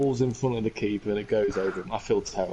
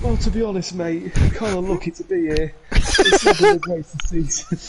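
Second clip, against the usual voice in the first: higher, louder, laughing confession; sheepish coach; 8 semitones higher, 7 dB louder than usual. Retold or imitated. Coach admits to Keane the season was poor, high laughing voice. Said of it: "He's like".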